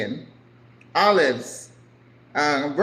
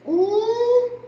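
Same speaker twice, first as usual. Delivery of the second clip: excited; 5 dB louder than usual. Real, not acted. Delighted at food.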